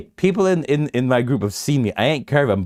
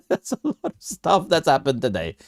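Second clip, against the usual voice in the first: laughing; 6 semitones higher than usual. Real, not acted. Repeats line while laughing.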